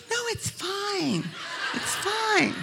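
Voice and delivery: squeaky, laughing "it's fine"; high laughing fake reassurance. Girlfriend hiding disgust from boyfriend, high laughing lie.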